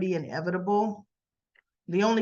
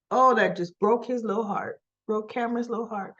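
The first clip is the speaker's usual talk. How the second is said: ordinary talk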